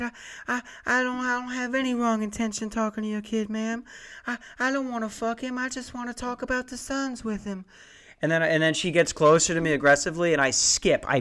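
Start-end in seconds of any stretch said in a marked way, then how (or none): 0.0-7.6 high, soft, breathy-free falsetto pop-star voice. Retold or imitated. Salesman answering the angry mom in a high falsetto. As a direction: go high and gentle like the singer pleading innocence. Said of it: "went full [name]".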